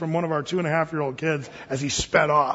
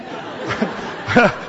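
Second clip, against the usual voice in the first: airy laugh; 2 semitones higher than usual. Real, not acted. Laughing about wife's outburst.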